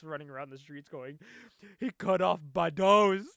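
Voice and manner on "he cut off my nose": loud, slow, stuffed-up yelp through a missing nose; mock nasal wail. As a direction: wail it like your nose was just cut off. Janice running round screaming, nasal.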